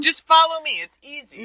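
high, sing-song teacher voice; mock instructor voice. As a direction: imitate a chirpy art teacher. Mocking the painting instructor.

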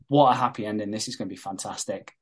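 ordinary delivery

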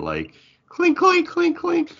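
high squeaky clink clink sounds; imitated chain clinks. Mimicking Spawn's rattling chains for friends, squeaky and high. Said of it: "You need to imagine".

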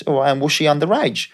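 cheery Aussie agent checking in on his player; Australian agent impression. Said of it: "And he goes".

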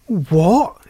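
astonished frog's exclamation; startled exclamation. A narrator's reading. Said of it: "exclaimed Frog".

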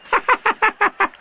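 very high gleeful cackle; boyish laugh. A narrator's reading. Derek laughs at muddy McBain, high and gleeful.

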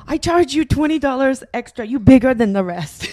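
put-on a light Chinese accent, blunt; mock Chinese tailor accent. Friend retelling the tailor's words, light Chinese accent. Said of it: "do it in a Chinese accent".

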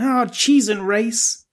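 drawn out slowly; "Oh, cheese and rice." stretched slowly and sweetly; slow mock-pious minced oath. Shown as a demonstration. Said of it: "you just be like".

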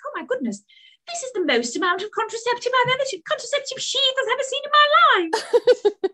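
very high-pitched astonishment, hammed up; mock shock. Podcaster acting out Trixie finding the contraceptives, mock-shocked.

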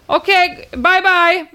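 high, hammed-up farewell call; mock shout. Poster sees neighbour off from window, mock shout. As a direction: call goodbye after a departing car.

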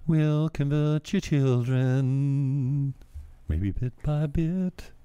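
slow, drawn-out sinister chant; mock ominous voice. Mocking a sinister convert-your-children drop. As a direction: creep them out, slowly.

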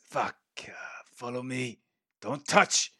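resigned lizard giving terse orders; lightly voiced lizardfolk guide. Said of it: "And he goes".

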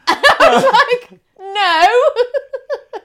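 high laughing no; breaking into laughter. Real, not acted. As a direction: laugh the word out, high and loud. Laughing through retold answer.

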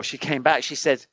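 ordinary talk

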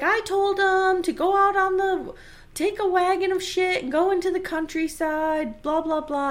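a hint of a snooty shopkeeper; mock Harriet. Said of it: "She's like".

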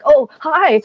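high, awkward quivering hello; mock your own timid greeting; nervous quiver. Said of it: "kinda quivering".

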